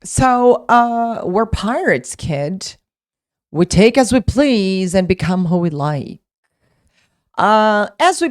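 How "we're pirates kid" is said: ordinary delivery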